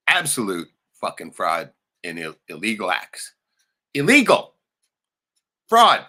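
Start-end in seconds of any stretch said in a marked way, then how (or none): 3.9-6.0 much higher, louder, each word punched; emphatic shout. Real, not acted. Ranting to viewers about the brother's fraud. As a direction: hammer Illegal and fraud.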